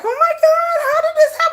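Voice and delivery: high, loud mock disbelief with a laugh; laughing parody of a stunned fan; mock upset fan. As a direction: squeal it like a shocked fan. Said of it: "people are going to be upset and be like".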